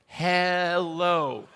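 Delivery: slow, drawn-out "Hello" with a clear L; "Hello" stretched out syllable by syllable, falling; lady teaching English. Retold or imitated. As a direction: say "Hello" slowly for a foreigner to copy. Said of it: "she'd look at me and she'd go".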